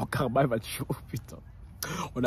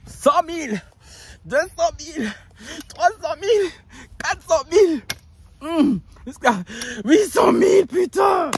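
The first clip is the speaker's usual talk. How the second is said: high, loud counting shouts; hammed-up excited counting yell; shouting out; 11 semitones higher, 7 dB louder, slower than usual. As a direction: yell the sums building up. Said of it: "crier comme ça".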